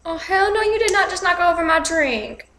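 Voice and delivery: high-pitched, indignant sass; sassy outrage. Real, not acted. Vlogger scolding someone on screen over a drink, sassy outrage. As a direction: react scandalized, voice pitched right up.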